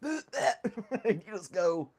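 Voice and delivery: slow, stunned cop voice; dumbfounded cop. Retold or imitated. Cop in Dumber movie handed pee bottle, slow stunned. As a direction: drag it out, lost for words. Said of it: "the cop's like".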